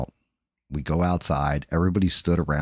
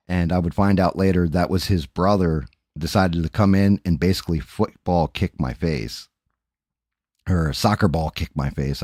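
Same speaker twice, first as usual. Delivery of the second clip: normal talking voice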